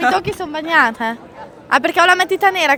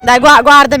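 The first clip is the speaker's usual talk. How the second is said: suddenly louder, calling attention; 10 dB louder than usual. Real, not acted. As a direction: call them to look. Showing fake tears.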